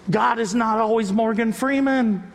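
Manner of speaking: high, ringing sermon delivery; impassioned preaching. Real, not acted. Preacher declares God's "I am what I am" to congregation, impassioned. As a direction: preach it up to a climax, pitch held high.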